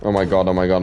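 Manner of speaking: usual speaking voice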